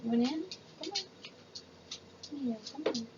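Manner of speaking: high coaxing dog voice. Real, not acted. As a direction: coax the dog inside gently.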